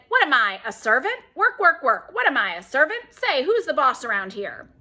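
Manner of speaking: loud, high indignant squawk; squawking parrot. Acted. Parrot protests about work to the dad, loud and high. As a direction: screech the complaint like a put-upon parrot.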